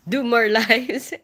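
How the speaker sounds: laughing. Real, not acted. Promising viewers more streams.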